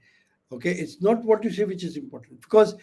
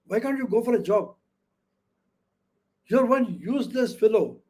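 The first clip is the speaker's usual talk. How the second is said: acted family telling-off, higher voice; bossy, abusive scolding; 4 semitones higher than usual. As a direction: scold like a commanding parent. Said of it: "they were abusive and bossy, commanding".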